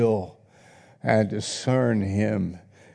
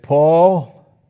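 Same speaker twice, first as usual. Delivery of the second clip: loud call; 5 semitones higher, 8 dB louder than usual. Acted. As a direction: call out Paul loud and high. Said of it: "Festus said with a loud voice".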